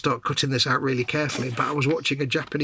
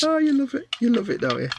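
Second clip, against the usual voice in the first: teasing; 10 semitones higher than usual. Real, not acted. Teasing after own pun.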